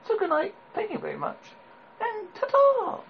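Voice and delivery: lilting high farewell; sing-song farewell. Pretend. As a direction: sing out the goodbye.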